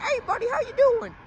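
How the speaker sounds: friendly southern officer, high-pitched imitation; nice southern accent. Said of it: "In his nice southern accent".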